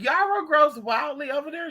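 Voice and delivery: high-pitched, amazed question; surprised question. Retold or imitated. Asking a woman about wild yarrow, surprised. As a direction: ask it high, rising in disbelief.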